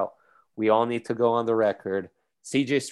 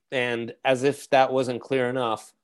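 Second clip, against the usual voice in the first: ordinary talk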